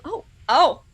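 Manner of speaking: ordinary delivery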